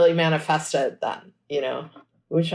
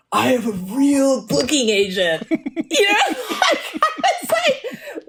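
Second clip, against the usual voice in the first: laughing; 7 semitones higher, slower than usual. Retold or imitated. Giddy retold thrill.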